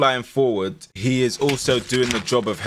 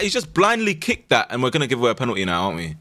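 ordinary delivery

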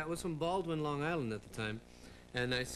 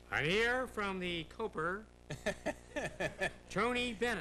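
slow, drawn-out host introduction, higher at the end; Ed Sullivan impression; 5 semitones higher, slower than usual. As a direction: stretch the words like a stiff variety-show host. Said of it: "I used to do Ed Sullivan introducing all these acts".

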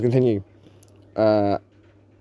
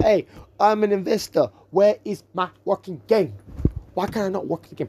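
mock angry investor; 6 semitones higher than usual. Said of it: "be like".

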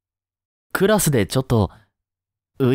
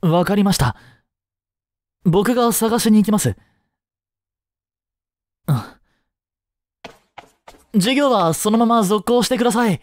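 higher, pressing and quick to act; urgent resolve; 8 semitones higher than usual. Acted. Teacher tells a colleague he will search, urgent. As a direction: snap into action to find the student.

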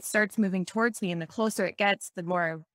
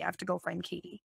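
much softer, quicker, drifting away; fading repeat; 2 semitones lower, 8 dB quieter, faster than usual. Retold or imitated. Mom departing the dream, repeating herself more and more quietly. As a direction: let the repeat fade out as she leaves. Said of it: "and she said".

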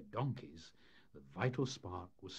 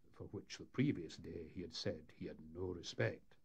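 ordinary delivery

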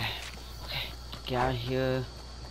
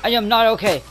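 loud, higher panicked wail; panicky; 5 semitones higher, 8 dB louder, faster than usual. Real, not acted. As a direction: say it like you're about to lose.

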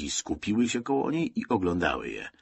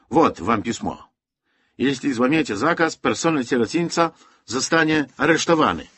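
harsh officer; 5 semitones higher, 5 dB louder than usual. Acted. Officer threatens arrest.

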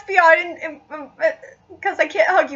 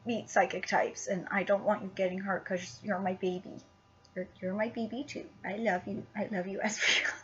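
whisper; 11 semitones lower, 17 dB quieter than usual. Real, not acted. Whispering love to plushie.

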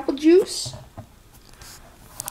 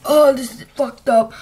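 louder real groaning complaint; loud pained outburst; 7 dB louder than usual. Real, not acted. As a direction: blurt it out in distress. Still choking on cinnamon.

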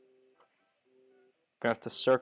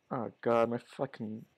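ordinary talk

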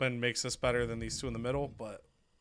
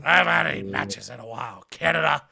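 wrestler role; 3 semitones higher, 8 dB louder than usual. Acted. Playing a neglected wrestler's complaint.

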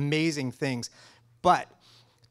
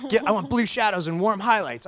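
mimicking a client's eager demand, higher and louder; 7 semitones higher, 6 dB louder than usual. Colorist imitating a client's request, higher and louder. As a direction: play the client firing off a wish.

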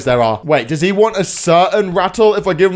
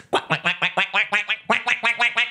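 soft quacking; duck quacks; 4 semitones higher, 7 dB quieter than usual. Acted. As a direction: quack softly like a toy duck. Baby imitates duck.

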